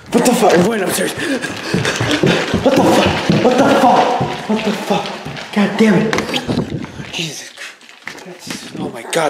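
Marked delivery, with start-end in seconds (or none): 0.1-7.1 much higher and louder, panicked; real panic, strained high cries; panicked screaming. Real, not acted. Sudden scare, panics upstairs. 7.1-8.8 softer, slow breathless whisper; shaken, barely voiced. Real, not acted. After the scare, breathless.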